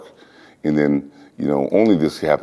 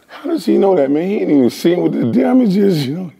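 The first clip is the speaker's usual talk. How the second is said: high, disbelieving inner voice; thinking in high disbelief; incredulous thought; 13 semitones higher than usual. Retold or imitated. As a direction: wonder how he could know. His own incredulous thought.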